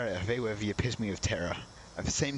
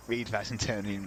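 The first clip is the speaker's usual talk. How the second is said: ordinary talk, nothing marked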